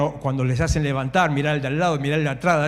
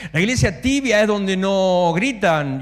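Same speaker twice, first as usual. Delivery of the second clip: ordinary talk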